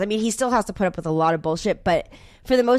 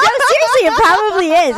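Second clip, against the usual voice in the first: much higher and louder insistence; emphatic teasing; 13 semitones higher, 8 dB louder than usual. Real, not acted. Teasing the host about being tight, emphatic. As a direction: push the point playfully. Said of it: "teasing the host".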